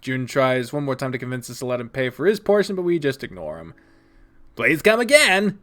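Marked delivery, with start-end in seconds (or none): 4.6-5.6 loud, high, drawn-out shopkeeper farewell; see customers off cheerily; cheerful send-off. Acted. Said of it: "sees us off with a cheerful grin".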